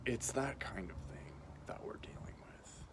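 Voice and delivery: hushed ominous whisper. Real, not acted. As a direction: breathe it like a secret. Ominous aside about dark forces.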